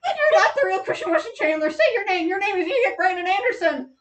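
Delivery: high, loud mock yell; yelling interrogator. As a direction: shout the demand at the captive. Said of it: "He goes".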